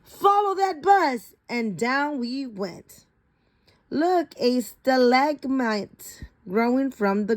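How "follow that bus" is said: high excited shout. Acted. Teacher shouts to the class to chase the bus, high excited. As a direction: call it out, chasing the bus. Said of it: "Frizzle shouted".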